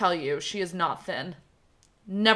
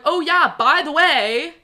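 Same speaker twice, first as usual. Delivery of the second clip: higher, louder mocking tattle; hammed-up gloating quote; mock smug aunt; 6 semitones higher, 6 dB louder than usual. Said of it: "It was like".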